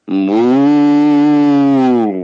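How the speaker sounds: long drawn-out American moo; American cow impersonation; American-accented cow. Shown as a demonstration. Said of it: "American cows must have an American accent".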